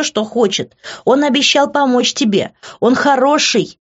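high, loud, heated reproach; angry shout. A narrator's reading. She keeps yelling at Grant about his date, angry and loud. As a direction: keep hurling it at him.

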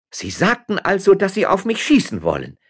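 high, loud, unbending challenge; defiant shout. A narrator's reading. Unbending woman confronts the man threatening to shoot, defiant shout. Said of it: "schrie die unbeugsame Frau".

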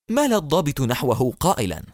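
usual speaking voice

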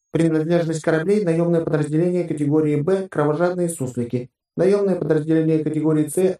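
ровный высокий доклад компьютера; отчекань как бортовой компьютер; голос бортового ИИ. A narrator's reading. Ship AI reports contacts. (голос корабельного ИИ)

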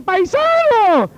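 high, long-drawn joyful call; exuberant hail. Retold or imitated. Stranger recognises a fellow Cuban's accent, exuberant hail. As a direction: stretch it out, hailing a long-lost countryman. Fellow countryman hails him excitedly.

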